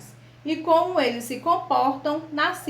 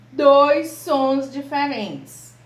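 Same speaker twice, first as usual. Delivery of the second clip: slow and louder, weight on DOIS; emphatic slow answer; 6 dB louder, much slower than usual. Shown as a demonstration. Teacher answers her own question for the class, slow and louder. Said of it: "teacher answers her own question".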